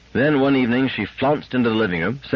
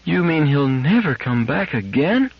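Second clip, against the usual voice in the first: put-on high, bewildered child voice; a small girl asking where her friend went; child's voice pitched high; little girl; 7 semitones higher than usual.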